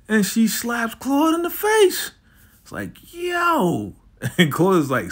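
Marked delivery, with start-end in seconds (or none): 0.1-2.1 high squeal. Real, not acted. Narrating a slap for effect. 3.1-3.9 squeaky startled "yo"; shocked high yelp. Retold or imitated. Claude yelps after being slapped, high and startled.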